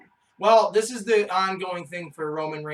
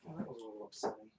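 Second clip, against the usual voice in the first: deadpan; 10 semitones lower, 19 dB quieter, much faster than usual. Pretend. Deadpan low aside.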